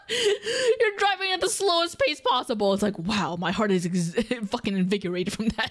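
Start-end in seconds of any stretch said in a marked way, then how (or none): 0.1-2.7 laughing. Real, not acted. Laughing at slow drive.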